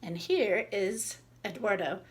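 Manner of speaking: ordinary delivery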